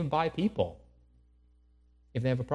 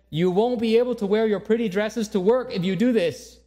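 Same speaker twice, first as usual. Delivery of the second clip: scolding mother; 8 semitones higher, 5 dB louder than usual. Acted. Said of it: "she finally ended and said".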